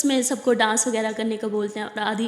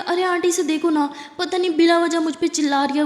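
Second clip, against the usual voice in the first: tattle to the aunt, pitched up; complaining to auntie; 6 semitones higher than usual. Acted. (Aunty سے اونچی، بلند شکایت)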